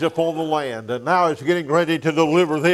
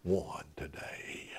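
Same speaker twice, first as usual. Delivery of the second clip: slow, low whisper of awe; preacher hushing the congregation, slow whisper; 9 semitones lower, 18 dB quieter, slower than usual. Acted. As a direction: let it fall away into a slow whisper. Preacher drops to hushed climax.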